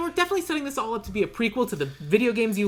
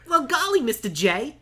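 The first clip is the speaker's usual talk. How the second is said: Harley Quinn; 6 semitones higher than usual. Shown as a demonstration. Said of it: "That's it. That's all I got".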